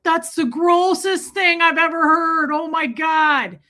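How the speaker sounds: loud, high, mock-disgusted yell; over-the-top grossed-out movie shout; Adam Sandler. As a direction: holler it in comic outrage, stretching the end. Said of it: "And Adam Sandler's like".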